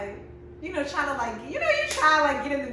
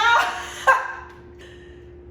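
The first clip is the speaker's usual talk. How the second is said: loud high laughing shriek; squealing laugh, louder; 14 semitones higher, 7 dB louder than usual. Real, not acted. Laughing shout.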